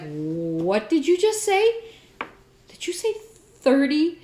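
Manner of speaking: slow, then pitch shoots up on thirty; can't believe the price she just heard; shocked disbelief. Retold or imitated. Guest stunned by the clerk's price, disbelieving.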